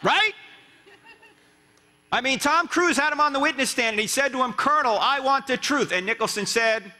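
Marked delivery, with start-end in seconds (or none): 4.5-5.9 a mild, half-hearted demand for the truth; underplayed courtroom demand. Shown as a demonstration. Tom Cruise confronting the Colonel on the stand, limply. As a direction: say it flat, like a limp first try. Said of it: "he didn't say it like that".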